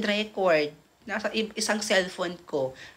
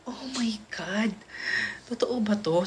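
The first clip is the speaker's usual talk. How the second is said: kilig whisper. Retold or imitated. Kinikilig, nagtatanong sa sarili.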